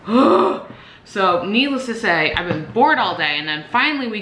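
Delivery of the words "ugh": exasperated gasp and groan. Retold or imitated. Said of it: "I was like, gasps".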